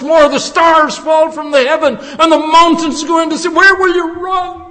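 loud, high, urgent pulpit warning; full-force preaching. Real, not acted. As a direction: cry out warnings to the congregation.

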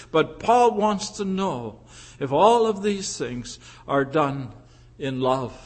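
normal talking voice

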